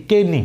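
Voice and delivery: "কেনি" as kay-nee, falling at the end; wrong reading "কেনি" in two syllables, kay-nee; K pronounced. Shown as a demonstration. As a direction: sound the silent K in "কেনি". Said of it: "যদি আমি কে সহতার উচ্চারণ করতে চাই".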